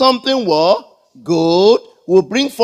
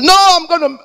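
high, raised refusal cut short; mock angry outburst; 9 semitones higher, 4 dB louder, faster than usual. Customer refusing to calm down at the bank, mock outraged.

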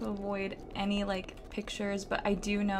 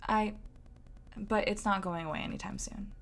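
ordinary talk

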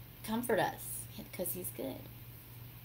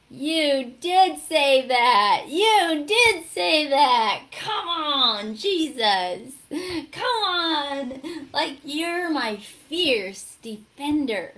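loud, high, drawn-out cheering; ecstatic shout; 6 semitones higher, 13 dB louder, much slower than usual. Retold or imitated. Worshipper answering Jesus her defender, ecstatic shouting. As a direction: shout it out in awe, stretching each word.

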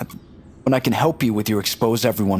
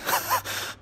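high airy squeal of a laugh; a quick delighted unvoiced giggle; giddy breathy giggle; 8 dB quieter than usual. Eager hacker suddenly allowed in.

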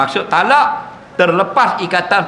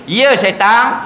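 cheeky defiance; 4 semitones higher, much slower than usual. Retold or imitated. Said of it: "dia kata".

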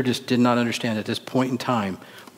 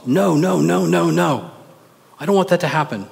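high, louder string of protests; vehement refusal; 6 semitones higher, 4 dB louder than usual. Acted. Peter refusing to let Jesus suffer, vehement. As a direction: protest in a raised, pitched-up voice.

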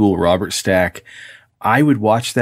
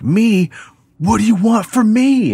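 panicked plea; 12 semitones higher than usual. Retold or imitated. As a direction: cry out in high-pitched terror. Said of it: "And he's like".

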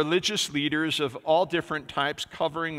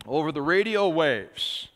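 normal talking voice

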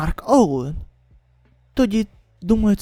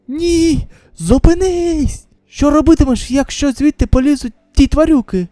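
alarmed shout; 7 semitones higher, 5 dB louder than usual. Acted. A survivor screaming at the man cutting open the hatch. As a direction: yell to stop him in panic.

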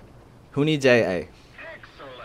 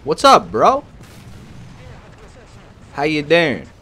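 loud mock greeting; 9 semitones higher, 10 dB louder, slower than usual. Real, not acted. Mock greeting after the kill.

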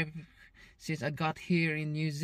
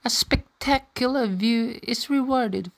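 documentary narration; 4 semitones higher, 14 dB louder than usual. Acted. Voiceover narration of the hike.

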